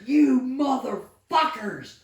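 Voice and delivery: high, slow furious shout at the army; angry yell. Retold or imitated. Soldier yelling at the army over his tanks, high furious shout. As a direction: scream it out like you've been betrayed. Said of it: "And I was like".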